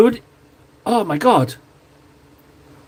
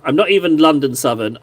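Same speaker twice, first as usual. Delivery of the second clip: usual speaking voice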